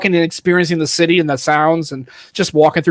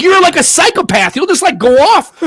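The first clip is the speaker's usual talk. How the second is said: exasperated wife; 9 semitones higher, 4 dB louder than usual. Retold or imitated. Voices wife's exasperated complaint.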